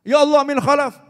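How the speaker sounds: loud, high cry to God; mock alarmed shout. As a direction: cry out loudly for replacement. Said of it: "Sampai teriak".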